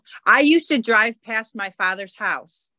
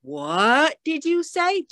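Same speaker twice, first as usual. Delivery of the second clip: higher, slow, astonished; stunned disbelief; 7 semitones higher, slower than usual. Retold or imitated. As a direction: ask as if you can't believe your ears. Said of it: "And I went".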